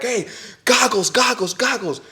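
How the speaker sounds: high-pitched urgent call; retold crew call-out. Jump crew warning everyone as the door opens, calling out. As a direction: imitate calling over rushing air.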